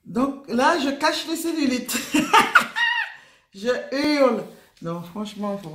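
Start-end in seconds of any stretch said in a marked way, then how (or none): 2.0-3.2 a sudden high, loud shriek of laughter. Real, not acted. Laughing at herself while showing her underwear, shrieking laugh. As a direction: burst out in a squealing laugh.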